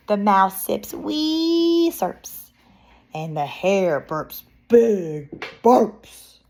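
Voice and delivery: expressive read-aloud with drawn-out sound words; picture-book narration. Acted. Reading a bear story to children, expressive narration. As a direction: play up the story's rhythm for children.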